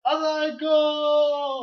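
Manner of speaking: high, loud drawn-out yell; laughing over-the-top call; mock holler. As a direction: shout it as a silly impersonation. Shouted wrestler imitation.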